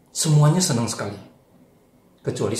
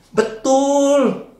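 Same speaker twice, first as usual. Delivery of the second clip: much higher, louder Betul; high exclaimed agreement; 14 semitones higher, 10 dB louder than usual. Acted. Storyteller voicing Anton, high loud agreement.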